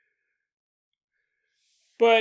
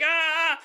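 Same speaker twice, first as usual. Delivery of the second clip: startled yelp; 10 semitones higher than usual. Retold or imitated. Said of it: "You're like".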